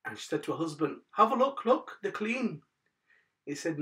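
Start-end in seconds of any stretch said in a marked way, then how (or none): 1.1-2.6 high-pitched, eager urging; lightly acted wife calling her husband's attention; pleased wife. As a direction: pipe up high to get someone to look. Wife urges husband to look.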